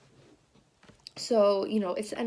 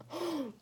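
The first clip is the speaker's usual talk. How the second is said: gasp; 7 semitones higher, 7 dB quieter than usual. Retold or imitated. Hearing Taylor wants to meet.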